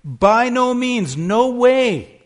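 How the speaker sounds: loud, slow, high "By no means", hammering "no"; emphatic proclamation. Acted. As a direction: thunder the denial from the pulpit. Preacher's emphatic denial.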